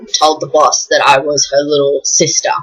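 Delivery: ordinary delivery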